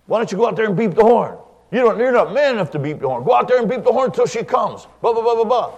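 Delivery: imitated goading, pitched high and rapid; high, pushy mimicry of a taunting man; baiting heckler. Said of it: "He was trying to bait me".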